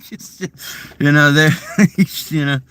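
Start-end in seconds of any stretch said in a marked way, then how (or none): none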